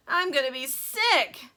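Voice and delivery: slow, loud, strained queasy groan; nauseous woman yelling. A narrator's reading. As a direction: moan it about to throw up. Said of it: "yelled Missus T V".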